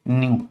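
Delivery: न्यू said like the English word new; pronounced nyoo. Shown as a demonstration. Teacher showing the class how to say न्यू, like new. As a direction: say न्यू as a single glide, n into yoo. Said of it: "इसको कैसे pronounce करते हैं? Pronounced as".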